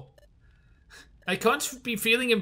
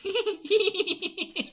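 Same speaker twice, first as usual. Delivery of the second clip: high laugh; 10 semitones higher than usual. Acted.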